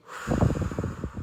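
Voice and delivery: audible deep exhale; demonstrative breathy sigh; cleansing breath. Shown as a demonstration. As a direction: let a long breath whoosh out.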